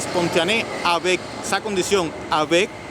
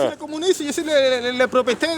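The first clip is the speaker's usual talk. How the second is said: mock tyrant boast; 7 semitones higher than usual. Voicing the communist ruler's claims.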